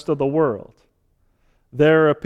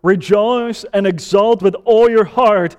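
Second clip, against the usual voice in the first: ringing, raised declamation; proclaimed Scripture reading; 3 semitones higher, 5 dB louder than usual. Real, not acted. Preacher reading Zephaniah to the congregation, proclaiming. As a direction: read it out as a joyful summons.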